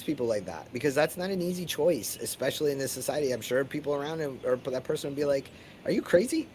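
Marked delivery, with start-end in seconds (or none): 5.8-6.5 incredulous high. Retold or imitated. Voicing doubtful friends.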